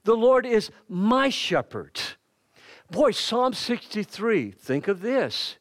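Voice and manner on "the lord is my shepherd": higher, louder and slow, leaning on LORD; proclaimed. Shown as a demonstration. Preacher declaring the psalm to the church, loud slow and fervent. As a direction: declare it slowly, with rising fervor. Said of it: "the shepherd is with me".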